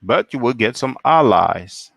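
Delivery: ordinary talk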